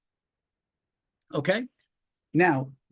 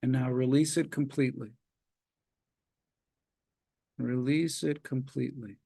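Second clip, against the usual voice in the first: calm, measured relaxation guide; walk them through tensing and releasing, unhurried; guided relaxation. Acted. Leads progressive muscle relaxation.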